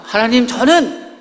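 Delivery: a lightly hammed-up Moses complaining; Moses pleading. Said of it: "모세가 또 하나님 앞에 가고".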